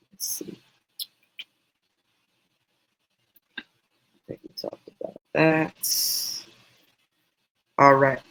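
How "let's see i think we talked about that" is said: muttering. Real, not acted. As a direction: mutter it under your breath. Muttering to herself while scrolling.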